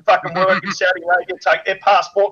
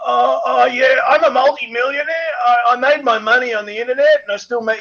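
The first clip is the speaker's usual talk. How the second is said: higher, half-shouted smug bragging; mock stoner boast; 6 semitones higher than usual. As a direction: ham up a stoned loser showing off. Mocking rich stoner bragging.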